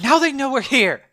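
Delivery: high, loud scolding shout; loud acted telling-off; exasperated yell. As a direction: yell at your partner for giving you away. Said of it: "she just sort of yells".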